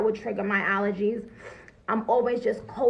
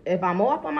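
usual speaking voice

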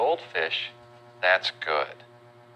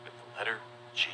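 soft hushed voice; 2 semitones lower, 10 dB quieter, faster than usual. Acted. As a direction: hush so the gorilla stays calm. Hushed near gorilla.

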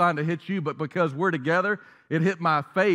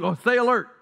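higher, louder startled "stay alert"; mock jolted awake; 7 semitones higher, 4 dB louder than usual. Shown as a demonstration. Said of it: "Somebody just woke up".

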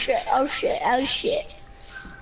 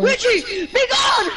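shouting; 9 semitones higher, 4 dB louder, slower than usual. Real, not acted. Fighting enemy player.

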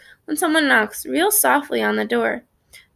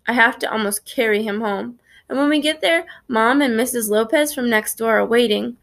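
ordinary delivery

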